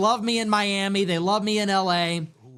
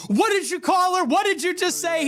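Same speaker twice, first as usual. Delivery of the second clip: outraged shout; 11 semitones higher than usual. Retold or imitated. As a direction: yell it in disbelief at the insult. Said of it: "And Piers Morgan's like".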